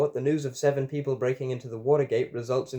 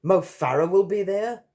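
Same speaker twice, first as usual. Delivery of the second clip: high, loud incredulous question; gasped astonishment; 8 semitones higher, 6 dB louder than usual. A narrator's reading. James gasps at news of a famous guest, astonished. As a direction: gasp it as a stunned question. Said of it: "James gasps".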